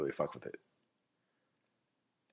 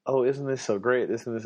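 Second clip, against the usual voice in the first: mock gushing; 5 semitones higher, 4 dB louder than usual. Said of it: "And they'd be like".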